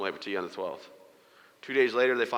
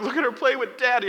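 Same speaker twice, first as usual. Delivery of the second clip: very high, louder mock cooing; imitated delighted mom; 13 semitones higher, 5 dB louder than usual. Mom and wife cooing, acted.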